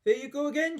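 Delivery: high, loud exasperated cry; grandma fed up with George, high shrill cry. Acted. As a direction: throw up your hands and cry it out. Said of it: "she cried".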